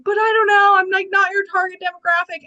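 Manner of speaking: high, loud, teary voice of the crying friend; high-pitched tearful outburst; imitating friend sobbing. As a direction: play the friend choking up through tears. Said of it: "and she's sobbing".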